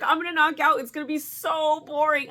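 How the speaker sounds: high-pitched, hammed-up dread of boredom; retold freaking out. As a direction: complain to an uncle in mock panic. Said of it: "I'm freaking out".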